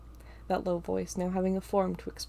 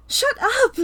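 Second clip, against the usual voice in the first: very high, loud furious shout; angry scream; 11 dB louder than usual. A narrator's reading. Star screams at Ava, furious shout. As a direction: scream her silent. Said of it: "Star screams at Ava".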